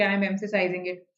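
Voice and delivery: usual speaking voice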